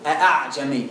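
"أأعجمي" with both hamzas fully sounded; "أأعجمي" with a hard second hamza; full hamza demo. Shown as a demonstration. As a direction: pronounce both glottal stops clearly in "أأعجمي". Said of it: "لو همزة كنت قلت إيه".

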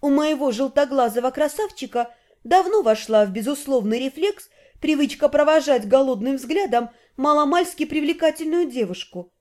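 ordinary delivery, nothing marked

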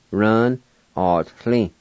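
ordinary talk

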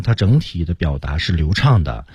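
ordinary delivery

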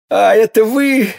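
high, strained dying man's outcry; loud anguished recognition; agonized cry. A narrator's reading. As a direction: cry out in accusing agony. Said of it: "вскричал Бризмон".

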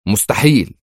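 emphatic cry of disbelief; exclaiming. A narrator's reading. Intelligence director refusing the DNA result, exclaiming. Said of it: "هتف مدير المخابرات".

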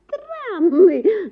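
wife indignant at her jealous husband. A narrator's reading. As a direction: bristle with offence. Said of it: "возмутилась дамочка".

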